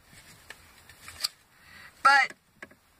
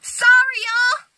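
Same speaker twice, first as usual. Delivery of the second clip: higher, louder irritated apology; real annoyance; 9 semitones higher, 6 dB louder than usual. Real, not acted. Girl telling viewers she's peeved, louder and higher. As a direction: let the frustration spill out. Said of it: "I'm just peeved off right now".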